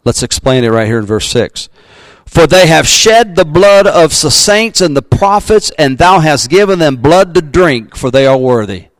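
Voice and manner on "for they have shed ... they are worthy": raised, ringing Bible reading; scripture reading. Acted. Pastor reading Revelation to the congregation, ringing proclamation. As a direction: lift the verse up like a proclamation.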